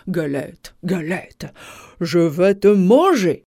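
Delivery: low, slow wolf threat; deep storybook wolf; menacing wolf. A narrator's reading.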